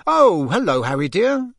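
lifted, affectionate mum voice; motherly greeting. A narrator's reading. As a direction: greet a dear guest fondly. Mrs Weasley smiles, greets guest.

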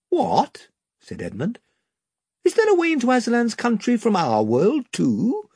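put-on a boy caught off guard; surprised boy. A narrator's reading. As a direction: Edmund asks about a way home, surprised. Edmund voiced surprised.

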